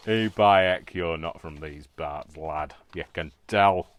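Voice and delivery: Yorkshire local. Retold or imitated. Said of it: "came something like that".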